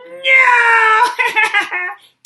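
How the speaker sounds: high, loud gleeful goblin cackle. Acted. Goblin laughs at Lily's wrong guess, loud high cackle.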